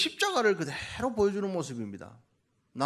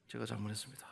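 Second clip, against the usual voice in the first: quiet honest apology; 8 semitones lower, 15 dB quieter, faster than usual. Shown as a demonstration. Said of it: "솔직히 그만".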